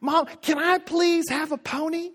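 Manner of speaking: put-on high pleading kid voice; begging child. Child begging parents for a pony, high and pleading. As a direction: whine it like a child wanting a pony. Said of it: "Imagine your child comes in and says".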